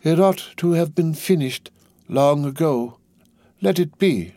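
put-on high, slow, detached old shoemaker; drawn out, lost in the work; far-away high murmur, not addressing anyone; absent muttering. A narrator's reading. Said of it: "he muttered without looking up".

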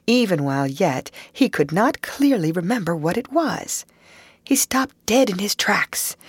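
usual speaking voice

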